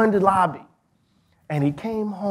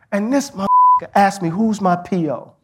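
louder, indignant; a father voicing his son's anger; outraged retelling; 3 semitones higher, 7 dB louder than usual. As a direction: spit out the insult in disbelief. Son's outraged retold quote.

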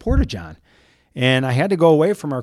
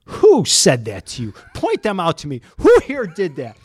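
indignant boss; 4 semitones higher, 4 dB louder than usual. Retold or imitated. Festival founder demanding who wronged the reporter, mock stern. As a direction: play the boss demanding a culprit.

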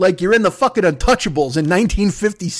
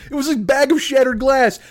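ordinary talk

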